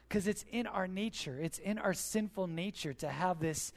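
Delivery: usual speaking voice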